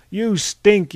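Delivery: high, loud jeer; loud, high-pitched heckle; heckling yell. A narrator's reading. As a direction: yell it as an insult from the crowd. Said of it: "yelled a Frenchman".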